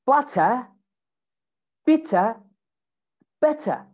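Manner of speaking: British "Butter, bitter, better", true T, "eh" ending; British accent. Shown as a demonstration. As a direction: drop each R the British way, word by word. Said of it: "change it with the eh sound".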